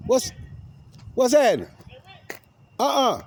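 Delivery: sudden loud, high-pitched call. Real, not acted. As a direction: break off and call out sharply high. Breaks off story, calls to someone nearby.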